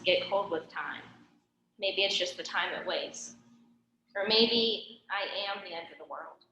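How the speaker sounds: measured spoken-word poem reading; poem recitation. Real, not acted. Poet reads her own poem to a live audience, poem recitation. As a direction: read your poem in phrases, pausing between them.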